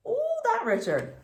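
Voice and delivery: high, exasperated squeal. Real, not acted. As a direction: sound fed up with him. Teacher reacts to rotten brother.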